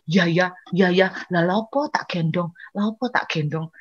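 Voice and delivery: low, soft realisation; quietly concede, as if to oneself; subdued, chastened self-talk; sheepish agreement. Retold or imitated. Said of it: "setelah diomongin gitu".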